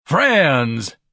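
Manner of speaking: loud, high orator's opening; pompous, ringing address; grand. A narrator's reading. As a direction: hail the crowd grandly. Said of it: "Ignatius said grandly".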